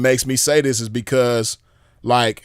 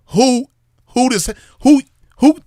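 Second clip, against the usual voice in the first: much higher, stammered outrage; sputtering disbelief; 10 semitones higher, 4 dB louder than usual. Real, not acted. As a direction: trip over the words, pitch shooting up.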